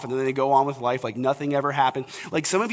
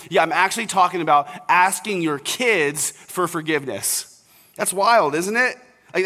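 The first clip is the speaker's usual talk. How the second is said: normal talking voice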